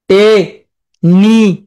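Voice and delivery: টে-নি-আস-লি said very slowly, each syllable apart; broken into syllables. Shown as a demonstration. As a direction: break টে-নি-আস-লি into separate slow syllables. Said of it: "ভেঙে ভেঙে খাতার মধ্যে লিখবে". (টে-নি-আস-লি ধীরে ধীরে ভেঙে ভেঙে বলা)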